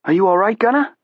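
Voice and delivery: high, jabbering South Boston voice; squeaky Boston sidekick voice; Southie accent. A narrator's reading. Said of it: "how you pronounced Gunner in Southie".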